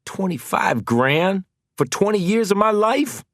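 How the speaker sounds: can't believe the sum; incredulous. Acted.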